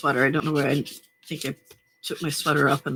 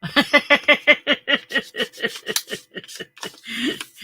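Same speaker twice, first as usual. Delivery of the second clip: laughing; 7 semitones higher than usual. Real, not acted. Laughs at a viewer.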